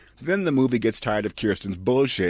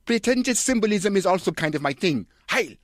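high, pompous mock-German director voice; smug art-house auteur declaring; Lars von Trier; 11 semitones higher, 3 dB louder than usual. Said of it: "Director Lars von Trier".

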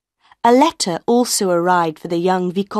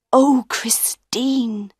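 repeated cry; 5 semitones higher, much slower than usual. A narrator's reading. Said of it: "he said again and again".

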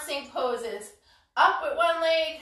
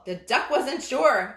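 ordinary talk

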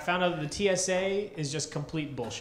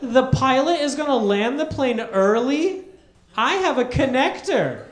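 high loud indignant rant; angry complaint; 6 semitones higher, 6 dB louder than usual. Retold or imitated. Scene: seatmate complains about missing her connection, high loud indignant rant. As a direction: rant like your day is ruined. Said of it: "she turns to me and goes".

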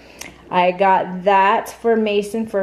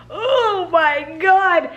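shocked; 8 semitones higher, much slower than usual. Real, not acted. Tasting sour candy.

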